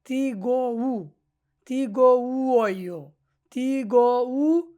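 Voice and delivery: তিগো উ high, loud and stretched, "tiii-gooo-uu"; teacher drawing out each syllable of তিগো উ; slow sounding-out. Shown as a demonstration. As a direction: sound out তিগো উ slowly and emphatically. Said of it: "এটাকে আমরা উ বলবো".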